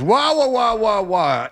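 high whining wah wah, mimicking the doorman; hammed-up imitation of a whining attendant; mocking whiny guy. Imitating the stadium guy's whining to friends. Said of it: "This is how it sounds".

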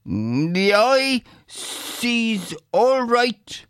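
high-pitched, bold Irish tenant; defiant peasant Bill. A narrator's reading. Bill refusing the agent his respect, defiant. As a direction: speak up high and cheeky, slowing to land the insult.

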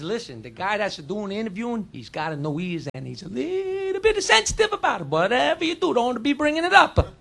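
put-on hushed Italian accent with stretched, sing-song vowels. Italian guy warns the Polish guy, softly, Italian accent. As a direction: confidential warning in a thick Italian accent. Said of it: "Italian guy goes up to the Polish guy".

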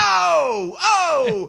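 very high startled oh oh; panicked high oh, oh; Paulie Walnuts yelp. Retold or imitated. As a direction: squeal out the fright in a high pitch. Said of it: "did the Paulie Walnut thing".

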